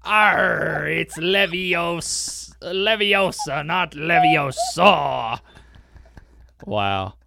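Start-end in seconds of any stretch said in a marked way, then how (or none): 0.0-5.4 loud, drawn-out pirate growl; hammed-up pirate correcting a spell. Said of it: "Pirate translations".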